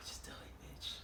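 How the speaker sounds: whispered self pep talk; hushed, breathy self-encouragement; whisper to herself. Retold or imitated. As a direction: psych yourself up in a whisper. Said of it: "and I just said".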